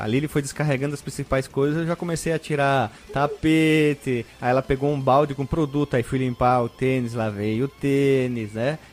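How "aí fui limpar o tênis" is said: ordinary talk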